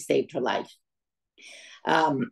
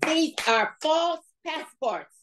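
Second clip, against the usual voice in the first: much higher, slow put-on official voice; accusing conductor; 11 semitones higher, much slower than usual. Russian conductor accusing the family of false passports, slow and much higher. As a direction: drag it out like a suspicious official.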